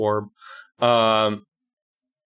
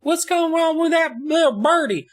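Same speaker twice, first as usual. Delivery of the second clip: put-on very high, childlike voice; hammed-up high kid voice; curious little boy. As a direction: squeak it like a puzzled small child. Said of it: "and Nathan's just like".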